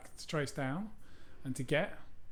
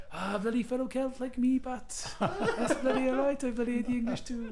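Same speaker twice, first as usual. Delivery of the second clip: higher, hammed-up Welsh accent with laughter; terrible Welsh accent; 9 semitones higher, slower than usual. Welsh local welcoming a fellow Celt, in a terrible Welsh accent. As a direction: ham up a bad Welsh accent, laughing through it. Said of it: "That's terrible Welsh accent".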